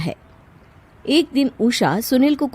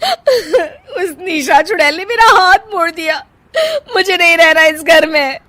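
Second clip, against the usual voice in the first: crying; 10 semitones higher, 6 dB louder than usual. Acted. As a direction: wail and sob about it. Crying after arm twisted.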